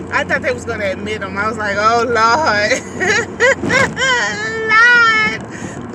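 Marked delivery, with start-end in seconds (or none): 1.8-2.8 loud, drawn-out mock despair; exasperated oh Lord. Retelling fear son admitted hospital. 2.8-5.9 high, loud laugh breaking into Lord. Real, not acted. Laughing after retold scare.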